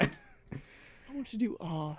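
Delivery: quiet, slow dreamy artist voice; drawn-out wistful artist murmur; soft mock Yusuke. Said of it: "repeats Yusuke's quote softly".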